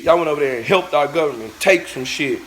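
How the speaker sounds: ordinary delivery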